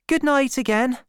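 put-on high-pitched girl saying good night; young girl's farewell. A narrator's reading. Girl bidding Gabriel good night.

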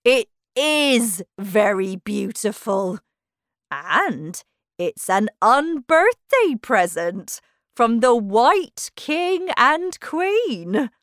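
loud, slow, pompous character; proud Humpty Dumpty. Acted. Humpty Dumpty showing off his present to Alice, proud and slow. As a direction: show off grandly, drawing the words out.

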